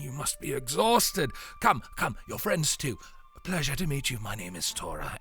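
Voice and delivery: put-on an old man clucking over his soaked son; fussing old man. Old man fusses over guests.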